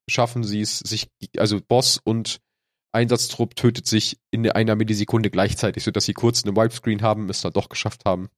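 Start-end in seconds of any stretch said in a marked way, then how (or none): none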